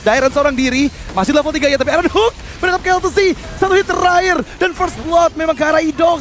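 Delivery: fast, loud hype play-by-play; esports caster. Real, not acted. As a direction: call the teamfight at full tilt. Hype play-by-play during fight. (caster esports yang heboh)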